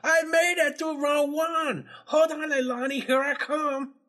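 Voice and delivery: high, boastful dimwit hero; proud Higgins. Retold or imitated. Host voices Higgins calling to Leilani, high and proud. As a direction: brag like a clueless superstar. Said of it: "He's like".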